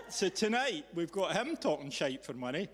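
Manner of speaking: ordinary talk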